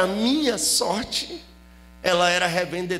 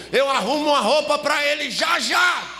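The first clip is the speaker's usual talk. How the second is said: high, loud yell from the next room; imitating a mother yelling through the wall; mother's shout; 8 semitones higher, 4 dB louder than usual. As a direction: holler it out as the eavesdropping mother. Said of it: "minha mãe tava ouvindo ... e gritou".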